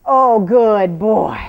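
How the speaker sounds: long, slow loud praise; drawn-out praise. Real, not acted. Trainer loudly praising her horse, slow and drawn out.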